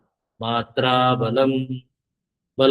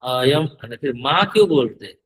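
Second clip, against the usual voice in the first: ordinary talk, nothing marked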